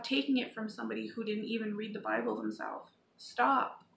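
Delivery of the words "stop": a sharp, higher, louder command; a forceful louder "Stop" to the viewers; firm loud stop. Real, not acted. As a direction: cut them off with one firm word.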